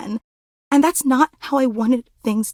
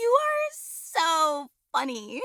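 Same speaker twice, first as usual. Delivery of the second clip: gushing laughter; 10 semitones higher, slower than usual. Acted. Fan flattering their hero, gushing laughter. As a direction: laugh hard, then draw out the praise. Fawning laughter at the fan's idol.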